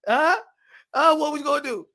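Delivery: ordinary talk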